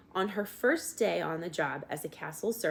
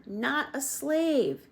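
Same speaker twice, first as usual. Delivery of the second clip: slow, higher, stressed slave; emphatic shout; 9 semitones higher, 4 dB louder, slower than usual. A narrator's reading. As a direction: drive the word slave home slowly. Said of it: "shouted the soldier".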